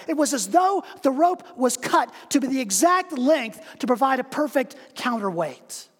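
normal talking voice

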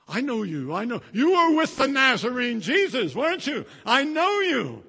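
high, loud pointed accusation; accusing servant girl. Acted. Servant girl calling out Peter before crowd, loud high accusation. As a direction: corner him with it.

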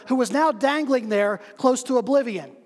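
ordinary talk, nothing marked

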